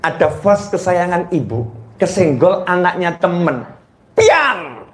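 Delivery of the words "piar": mock crash sound. Vas kesenggol pecah.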